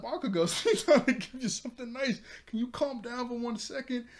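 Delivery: high-pitched, hammed-up pleading; exasperated scolding. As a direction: act out bystanders scolding a hothead, laughing a little. Acting bystanders scolding yelling Bakugo.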